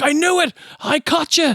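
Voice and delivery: high-pitched mock-angry accusation; angry outburst. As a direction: burst out as if catching someone red-handed. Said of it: "he's so angry".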